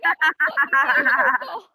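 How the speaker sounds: loud, proud caveman boast; mock proud shout. Mocking the proud southerner, a loud boast. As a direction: bellow it like a proud brute. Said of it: "that's like saying".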